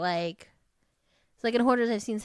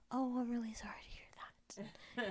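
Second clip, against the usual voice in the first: mock sympathy, whispered and much softer; fake compassion; 4 semitones higher, 10 dB quieter than usual. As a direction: breathe it like hollow pity. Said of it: "or fake compassion".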